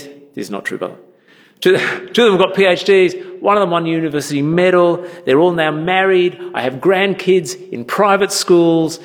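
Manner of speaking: normal talking voice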